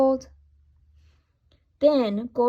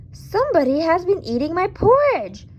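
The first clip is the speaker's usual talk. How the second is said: Papa Bear, louder, higher and growly; a big bear grumbling loudly about his porridge; loud growling bear; 7 semitones higher, 5 dB louder than usual. A narrator's reading. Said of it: "he said in his loud, growly voice".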